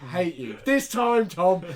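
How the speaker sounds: half-joking vow of revenge at Tom; hammed-up angry "this time"; mock vengeful. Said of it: "you're like".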